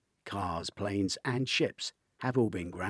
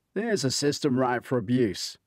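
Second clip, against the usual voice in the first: blunt remark; 4 semitones higher, 5 dB louder than usual. A narrator's reading. Hunter states the obvious.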